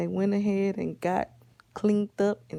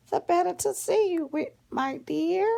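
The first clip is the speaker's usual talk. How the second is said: high-pitched fake granny, lilting upward; crafty high grandma disguise; wolf posing as grandma; 10 semitones higher than usual. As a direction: answer sweetly in a put-on high voice. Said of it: "The wolf dressed up like her grandma".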